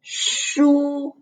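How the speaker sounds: SHU drawn out high and level; first tone. Shown as a demonstration. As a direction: stretch SHU on one flat high note. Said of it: "Remember the first tone".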